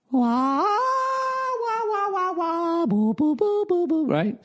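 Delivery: high, drawn-out silly wah wah boo boo noises; goofing around making sci-fi sounds up high; mock spaceship noises.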